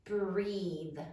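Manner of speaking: ordinary delivery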